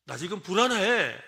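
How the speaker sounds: mock anxious. Shown as a demonstration. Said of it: "라고 말하라는 겁니다".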